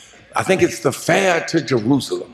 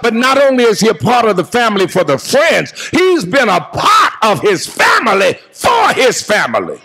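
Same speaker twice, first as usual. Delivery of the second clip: full preaching; 5 semitones higher than usual. Acted. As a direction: preach it out at full force to the mourners. Eulogy preached to the congregation.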